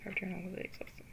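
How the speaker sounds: soft unvoiced whisper; whispered narration. Narrator telling of arriving home, whispered. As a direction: breathe the words as a whisper.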